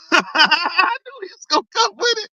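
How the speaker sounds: giggling while he speaks; laughing. Real, not acted. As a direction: laugh the words out. Laughing at Carlos's excuse.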